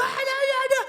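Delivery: high-pitched exaggerated whining; loud, high mock lament; mock complaining wail. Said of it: "langsung ngeluh".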